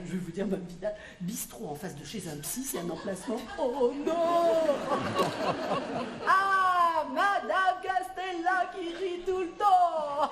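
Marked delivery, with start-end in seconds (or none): 3.6-10.3 delighted exclamation. Acted. Greeting a laughing customer.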